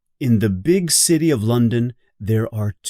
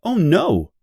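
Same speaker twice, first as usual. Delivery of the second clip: a higher, startled oh no; surprised; 8 semitones higher, 3 dB louder than usual. Acted. Wendy realises her parents may forget, surprised. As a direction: gasp it out in sudden alarm. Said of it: "Wendy is very surprised".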